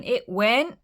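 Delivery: plain reading voice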